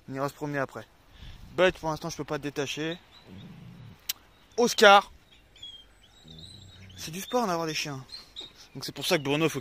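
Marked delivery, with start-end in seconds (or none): 4.6-5.1 loud sharp call of Oscar; calling dog. Real, not acted.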